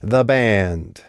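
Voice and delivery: drily mocking toast; toasting the band with mock salute. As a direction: sneer the words the band. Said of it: "raised her glass in mock salute".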